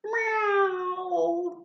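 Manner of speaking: high drawn-out meow; a cat answering its owner. A narrator's reading. Cat Oliver meowing to Miss Tilly, high and drawn out. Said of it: "replied Oliver".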